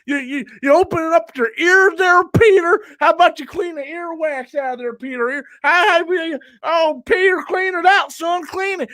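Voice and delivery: high-pitched, hectoring call; mock exasperation. Mock heckling of a commenter.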